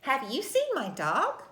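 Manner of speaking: read-along question, "seen" stressed, rising finish on "dog"; questioning rise. Shown as a demonstration. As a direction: ask it expressively, lifting the end on "dog". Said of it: "Read it with me using expression".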